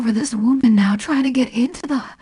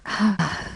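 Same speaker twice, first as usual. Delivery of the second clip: long, soft falling sigh; 3 semitones lower, 5 dB quieter than usual. Real, not acted. Storyteller winding down after the ordeal, soft sigh. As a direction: let out a weary breath.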